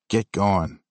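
gruff Cape Breton bark; Cape Breton accent. Retold or imitated. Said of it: "bark in his thick Cape Breton accent".

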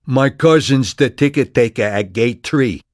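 brash street-corner New York voice; thick New York accent. A narrator's reading. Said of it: "his lyrically thick accent".

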